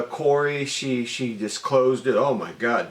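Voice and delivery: usual speaking voice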